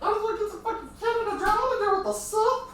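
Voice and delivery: high quick squeaky gremlin voice. Acted. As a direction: chatter fast like a gremlin. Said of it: "I'm currently in gremlin voice".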